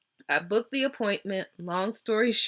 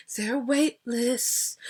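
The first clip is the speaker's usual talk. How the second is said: polite wait-list ask; 2 semitones higher than usual. Shown as a demonstration. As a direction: ask it with a little drama but nice. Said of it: "you gotta say it like that".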